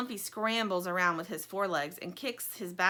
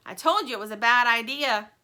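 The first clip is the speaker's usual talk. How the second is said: louder scolding call; calling from window; 3 semitones higher, 10 dB louder than usual. Acted. Stingray scolds Lumpy from the window, loud call. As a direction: call it down from the window, told-you-so.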